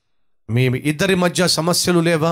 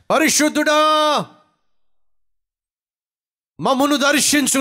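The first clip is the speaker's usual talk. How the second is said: slow, high, drawn-out pleading prayer; fervent prayer; 9 semitones higher, slower than usual. Acted. Pastor praying for troubled families, high slow plea. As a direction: stretch each word upward, begging God.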